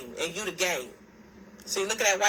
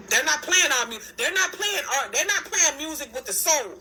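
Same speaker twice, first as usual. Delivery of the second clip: heated outburst; 6 semitones higher than usual. Real, not acted. Ranting about radio music.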